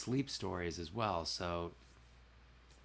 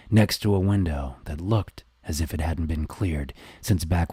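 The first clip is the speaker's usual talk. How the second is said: mysterious narrator voice; 2 semitones lower, 11 dB louder than usual. Shown as a demonstration. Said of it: "Can you give a sample of the mysterious narr-, mysterious narrator voice?".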